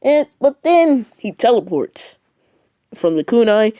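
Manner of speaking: ordinary delivery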